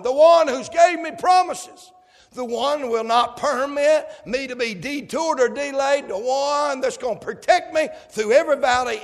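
rising preacher fervor; fervent preaching. Acted. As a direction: build the praise line by line.

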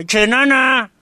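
high, loud mock catcall; hollered high and loud; imitated street shout. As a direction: yell it like a driver hollering at a passer-by. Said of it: "Le grita a una muchacha".

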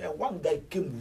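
normal talking voice

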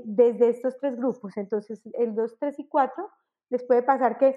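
ordinary talk, nothing marked

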